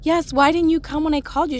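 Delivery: high, quick mock protest; indignant child. Child asks mom why she didn't come, mock indignant.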